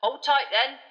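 high, loud cheery bus conductor; brash, cheerful young conductor calling out; Stan voice. A narrator's reading. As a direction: belt it out like a chirpy Cockney conductor. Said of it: "said Stan".

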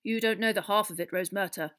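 understated gruff official. A narrator's reading. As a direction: tell her gruffly, with weight. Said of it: "said Fudge gruffly".

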